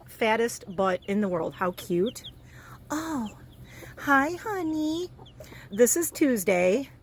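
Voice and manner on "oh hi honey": soft cooing. Real, not acted. Greeting a chick softly.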